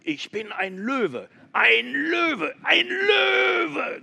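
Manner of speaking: building from firm to screaming high shout; loud roar. Acted. Goat insisting to the giraffe, building to a scream. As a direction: rise to a desperate screamed roar. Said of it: "Ganz laut brüllte sie".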